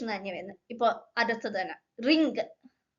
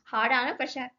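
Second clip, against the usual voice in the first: normal talking voice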